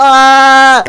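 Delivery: loud frightened cry; scream. Acted. Kid screaming while being chased. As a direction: yell out in alarm.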